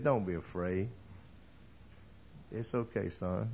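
calm a frightened man softly; gentle reassurance. Acted. David soothes the trembling Mephibosheth in a soft, hushed voice.

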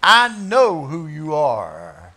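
loud, very slow and strange; possessed man crying out; demon confessing. Acted. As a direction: drag out each word, loud. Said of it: "stands up in the synagogue and confesses".

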